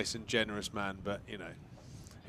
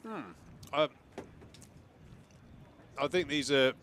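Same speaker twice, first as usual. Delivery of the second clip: talking through a biscuit; chewing between words; mouth full; 2 semitones higher than usual. Real, not acted. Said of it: "Pete and biscuit commentating".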